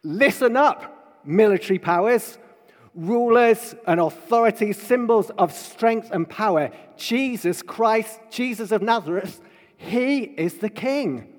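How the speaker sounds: high, loud herald's proclamation; booming proclamation. Acted. Preacher proclaiming Pilate's sign to rulers and military powers, loud proclamation. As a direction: proclaim it forcefully to the powerful, drawing out the key words.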